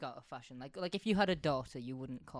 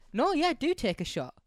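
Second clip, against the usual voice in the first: excited; 9 semitones higher, 8 dB louder than usual. Real, not acted. Urging a drinking game shot.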